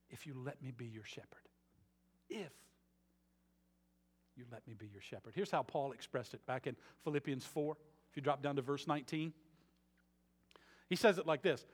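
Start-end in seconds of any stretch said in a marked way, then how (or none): 0.1-5.3 soft promise. Acted. God's promise, hushed and repeated.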